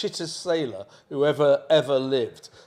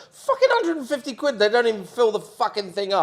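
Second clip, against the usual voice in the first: mock furious rant, pitched up; huffing about the price in a raised voice; retold fury; 6 semitones higher than usual. Complaining to his friend about the boat fee, mock fury. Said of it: "I was furious. I go".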